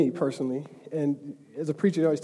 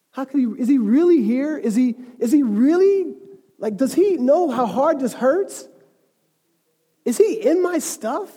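raised, louder voice of a doubting believer; anguished questioning; 6 semitones higher, 5 dB louder than usual. Acted. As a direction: plead for an answer, slowing on the last questions. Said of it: "some of us are wondering".

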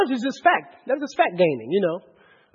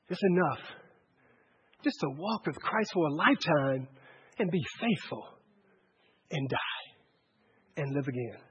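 low, very soft, with long pauses; hushed reflection; 8 semitones lower, 12 dB quieter than usual. Real, not acted. Preacher telling congregation a faithful walk is enough, hushed. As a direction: let each phrase sink away quietly.

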